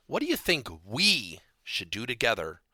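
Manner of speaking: coach's question with "we" punched; stress on "we". Shown as a demonstration. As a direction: lean hard on the word "we". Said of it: "Notice I emphasize the word we".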